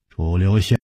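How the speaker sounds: plain reading voice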